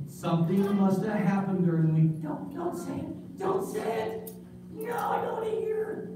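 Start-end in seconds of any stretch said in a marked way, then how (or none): 2.2-6.1 put-on high, hushed, frightened boy begging; high, soft puppet boy in mock dread; pleading puppet kid. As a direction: beg him not to say it. Puppet Gus dreads news.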